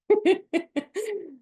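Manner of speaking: high, loud burst of laughter; big laugh. Real, not acted. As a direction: crack up at your own pun. Laughing at bottom of barrel pun.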